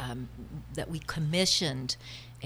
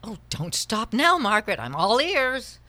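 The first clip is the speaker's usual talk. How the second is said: sarcastic goading; 5 semitones higher, 6 dB louder than usual. Acted. Acted quarrel, goading her friend.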